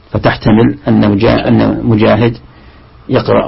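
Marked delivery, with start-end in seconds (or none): none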